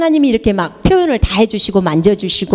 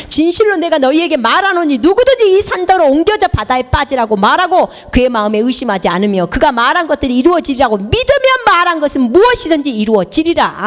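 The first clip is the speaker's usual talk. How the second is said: solemn, raised Bible reading; scripture recitation; 6 semitones higher than usual. Acted. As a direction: proclaim the verse to the church. Reciting Mark 11:23. (성경 낭독)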